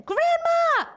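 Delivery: high desperate scream of Grandma. Retold or imitated. Girl screaming for her grandma, high piercing shout. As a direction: shriek it like a terrified child.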